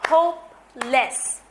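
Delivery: two slow beats for Hopeless; syllable beats. Shown as a demonstration.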